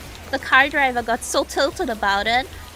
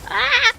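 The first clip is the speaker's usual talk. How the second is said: sudden high shriek; startled shriek; 12 semitones higher, 8 dB louder than usual. Real, not acted. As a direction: yelp in shock. Dying in the game.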